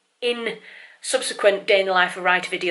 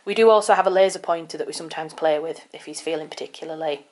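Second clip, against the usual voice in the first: usual speaking voice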